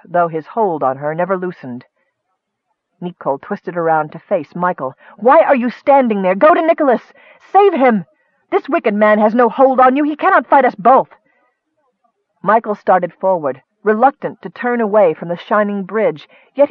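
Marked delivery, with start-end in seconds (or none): 5.2-11.1 high, loud desperate urging; frantic demand to save her brother; pleading shout. A narrator's reading. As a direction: beg him to go, voice up and loud. Nicole begs Michael to help.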